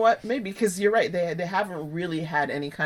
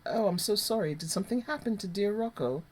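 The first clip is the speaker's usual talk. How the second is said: falsely sweet, softer and higher; mock sympathy; 4 semitones higher, 6 dB quieter than usual. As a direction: fake concern with a sneer underneath. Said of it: "Sidwell looks her in the face and goes".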